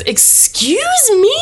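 high, loud, drawn-out affront; Gertie. Acted. Grandmother answering Aunt Susan's snub, outraged. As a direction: stretch it out in outraged disbelief. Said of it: "Gertie says".

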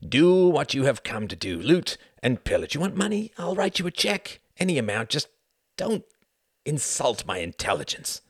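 bargain, voice jumping up on the offers; exasperated pleading. A narrator's reading. The Count offers Jorgen money, exasperated pleading.

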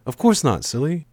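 high-pitched, light amused answer; understated laugh in the voice; laughing reply. A narrator's reading. Girl teasing the narrator for asking, mildly laughing.

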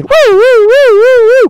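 high loud siren wail; siren imitation.

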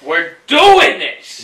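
slow, loud hype shout; hyped up. Pretend. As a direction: pump up the crowd. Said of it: "you have to come with that energy".

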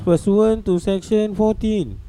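slow formal official reading; election announcer voice. Retold or imitated. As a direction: read it like a returning officer declaring results. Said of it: "exactly like that guy who read".

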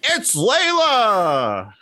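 slow high announcer call. Retold or imitated. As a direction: stretch it like an announcer. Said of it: "what Michael Cole sounds like".

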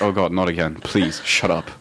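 mock fed-up listener. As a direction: groan it like a listener sick of him.

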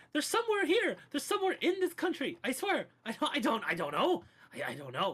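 high, panicked babbling; panicked pleading. Acted. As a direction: beg for your life in panic. Interviewer begs at knifepoint.

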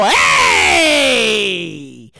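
loud, full-energy shout; loud energetic hey. Shown as a demonstration. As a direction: throw all your energy into it. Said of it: "This loud voice, all this energy".

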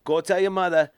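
hammed-up Boston townie; Boston accent. Co-host showing off his best accent, hammed-up Boston. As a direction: drop the r's like a mock Bostonian. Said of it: "your best accent you can talk in".

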